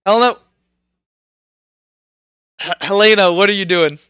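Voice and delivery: ordinary talk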